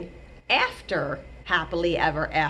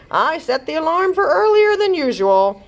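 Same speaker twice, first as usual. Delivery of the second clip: husband voice; 9 semitones higher, 6 dB louder than usual. Acted. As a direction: say sorry gently to your wife.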